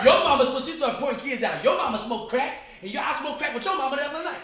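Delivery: high, loud imitated call-out; single out each kid at full volume; accusing tirade. Aunt points out kids one by one, high loud mock accusation.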